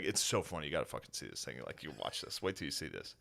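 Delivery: soft, low imitation of selling a clip; sales pitch. Sister showing the family a clip, selling it quietly. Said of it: "then she would sell it".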